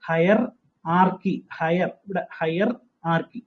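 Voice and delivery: "ഹയർ ആർക്കി" said slowly as two parts; teacher splitting "ഹയർ ആർക്കി" to show the spelling; split syllables. Shown as a demonstration. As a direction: break the word into ഹയർ and ആർക്കി. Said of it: "ഹയറിന്റെ spelling അറിയാം".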